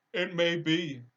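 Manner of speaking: high, loud, slow lion voice; a big cowardly lion protesting. Acted.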